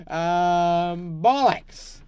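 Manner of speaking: high, loud laugh into bollocks; laughing curse. Real, not acted. Builder laughs and swears loudly at his wall. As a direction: laugh then swear at the mistake. Built wall over door.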